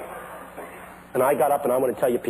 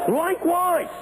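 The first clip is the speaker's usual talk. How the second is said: high drawn-out exclamation; 10 semitones higher, slower than usual. Acted. Says the word twice, contrasting.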